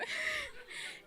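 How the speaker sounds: a short high-pitched laugh; a quick giggle. Real, not acted. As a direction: giggle at herself.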